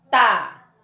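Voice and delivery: "tá" alone, loud and firm; isolated, emphatic "tá"; stressed syllable. Shown as a demonstration. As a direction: hit "tá" as the strong syllable. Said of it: "Maior intensidade na sílaba".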